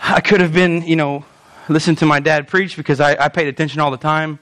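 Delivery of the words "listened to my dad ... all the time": low, deadpan sarcasm; dry sarcastic aside in a lower voice. Real, not acted. Preacher joking about listening to his dad, sarcastic. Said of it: "That's sarcasm".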